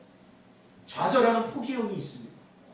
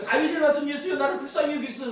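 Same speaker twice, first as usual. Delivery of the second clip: higher-pitched retold plea, mildly called out; crying out; 6 semitones higher than usual. Blind man calling to Jesus for mercy, mild cry. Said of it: "소리를 지르니까".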